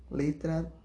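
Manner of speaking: ordinary talk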